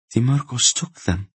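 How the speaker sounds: dead voice. A narrator's reading. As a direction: answer with the feeling drained out. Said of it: "replied in a dead voice".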